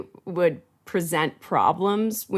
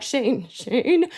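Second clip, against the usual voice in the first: laughing plea; 6 semitones higher than usual. Retold or imitated. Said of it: "cliffhanger questions that I'm like".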